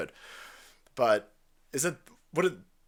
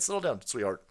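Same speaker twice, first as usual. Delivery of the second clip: normal talking voice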